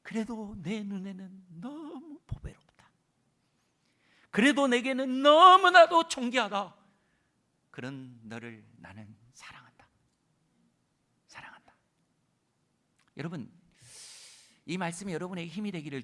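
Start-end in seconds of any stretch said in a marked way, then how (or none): 0.0-2.9 slow, tender whisper as God. Acted. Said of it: "속삭이듯". 4.3-6.7 loud, high, slow declaration; forceful. Acted. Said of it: "힘있게 말씀하십니다". 7.7-11.7 whispered. Acted. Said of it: "속삭이듯".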